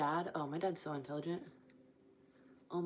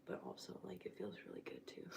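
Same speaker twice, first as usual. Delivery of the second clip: soft unvoiced whisper; 13 semitones lower, 10 dB quieter than usual. Real, not acted. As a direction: whisper it, drained. Exhausted aside.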